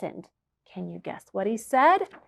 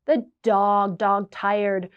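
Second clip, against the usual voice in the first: plain reading voice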